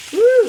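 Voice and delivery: a high, loud woo; excited whoop. Real, not acted. Showing the frying meat, a high loud woo. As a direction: holler at the sizzle. Sizzling pan shown.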